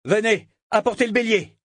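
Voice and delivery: ordre crié, aigu et fort; cri. A narrator's reading. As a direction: Brachen crie à ses hommes de le suivre. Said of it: "Puis il cria". (cri de capitaine pressé)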